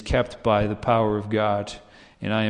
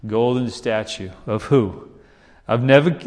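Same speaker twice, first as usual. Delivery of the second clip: ordinary delivery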